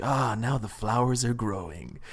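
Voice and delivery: lightly voiced, slow and soft silly line; a hint of a ridiculous game hero, said slowly; ridiculous game character voice. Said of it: "the guy always talks in, like, a really ridiculous way".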